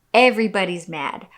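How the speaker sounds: normal talking voice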